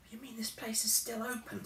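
high, breathless whisper of disbelief; astonished whisper. A narrator's reading.

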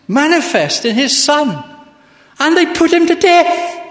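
high, ringing sermon at full force; urgent, emphatic preacher's delivery; impassioned preaching. Real, not acted.

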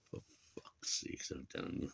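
breathy whispered curse under the breath; fed up with Thatcher's Britain, whispering; exasperated whisper. Real, not acted. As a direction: mutter it out as air. Exasperated aside.

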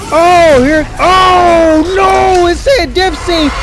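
loud, very high wailing protest; real high-pitched panicked yelling; panicked screaming. Real, not acted. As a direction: scream in dismay as it all goes wrong. Screaming as round two starts.

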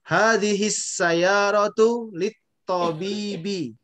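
measured recitation of "Hadihis sayyaratu lit tobibi"; model reading for repetition. Shown as a demonstration. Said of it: "tirukan saya".

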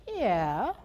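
drawn-out, unconvinced imitated yeah; imitated uneasy answer. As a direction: act out a reluctant, uneasy reply. Uneasy colleague, retold hesitant answer.

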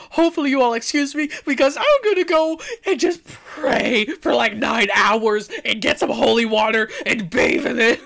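high, loud half-laughing panic; panicked. Real, not acted. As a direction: blurt it out in a scared rush. Jokes about praying after scare.

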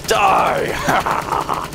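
rough shouted cry; battle cry. Real, not acted. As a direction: yell it as you attack.

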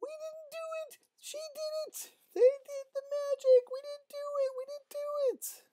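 very high squeaky falsetto; protesting girls. Acted. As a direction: plead innocence in a small, high kid voice. Girls chase boys, disclaiming magic.

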